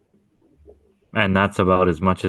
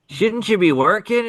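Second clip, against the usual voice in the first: imitated challenge in a much higher pitch; mock questioning; 14 semitones higher than usual. Old worker challenging the boyfriend about his gaming, mock questioning. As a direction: pitch it way up as a retold jab.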